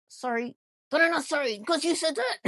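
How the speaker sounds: loud, high, bickering retort; mock quarrel. Imitating housewives bickering, mock quarrel. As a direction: fire back in a hammed-up squabble.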